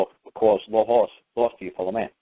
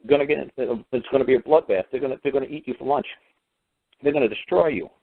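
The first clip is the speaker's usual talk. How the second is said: ordinary delivery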